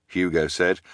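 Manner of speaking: ordinary delivery, nothing marked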